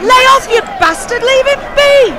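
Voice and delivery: outraged yell at the policeman beating a miner; furious shout. Acted. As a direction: scream at the policeman to stop.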